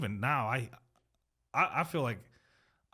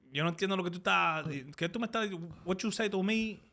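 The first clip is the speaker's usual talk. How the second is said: put-on higher, halting Spanish-accented English; feigned non-English speaker; 4 semitones higher than usual. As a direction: play confused behind a thick Spanish accent. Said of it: "I pretend not to know English".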